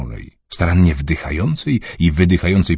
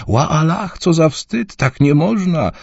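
high lament, wailing; moaning; 11 semitones higher, slower than usual. Acted. Fretting mother laments at the parking lot. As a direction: cry out in shame. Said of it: "pojękiwała".

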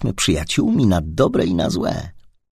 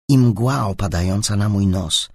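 plain reading voice

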